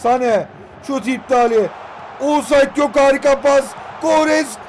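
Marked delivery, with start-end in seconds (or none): 2.2-4.6 excited shout. Real, not acted. Attack builds, he calls out.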